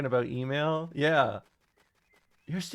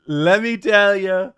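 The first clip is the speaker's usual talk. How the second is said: slow, louder Trump catchphrase; Trump impression; 4 semitones higher, 9 dB louder than usual. Trump pitching to Bubba, slow and loud. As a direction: drag it out like Trump savoring it. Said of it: "Trump tells Bubba".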